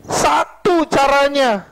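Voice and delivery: high, ringing pulpit delivery; preaching at full force. Real, not acted. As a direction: drive each question home to the congregation. (khotbah berapi-api dengan suara lantang)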